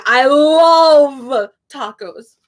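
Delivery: LOVE drawn out long and loud; stress on love. Shown as a demonstration. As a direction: stretch out the word love. Stressing love over want.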